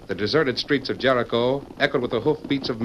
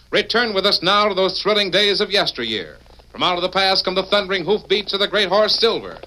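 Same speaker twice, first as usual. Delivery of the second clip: grand announcer build; 6 semitones higher than usual. Acted. Announcer calls listeners back to yesteryear, swelling announcer read. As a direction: sweep the listeners back into the past.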